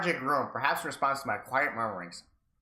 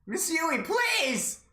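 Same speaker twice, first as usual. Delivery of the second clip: much higher, pleading cry; desperate plea; 14 semitones higher, much slower than usual. Acted. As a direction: beg her, high and desperate. Satoshi pleading.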